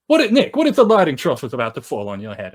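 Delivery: second-hand impression of a goading singer; loud mock interjection. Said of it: "[name]'s impression of [name] is like".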